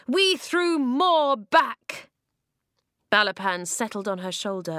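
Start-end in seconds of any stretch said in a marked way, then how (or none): 0.1-2.1 slow, loud and raised, defiant; defiant slow declaration. Acted. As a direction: drive each word home slowly. Climax of speech.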